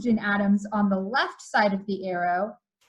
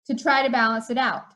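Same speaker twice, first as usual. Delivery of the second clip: normal talking voice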